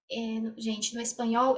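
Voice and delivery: ordinary delivery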